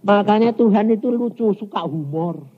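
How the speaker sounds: usual speaking voice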